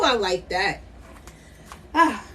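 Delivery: usual speaking voice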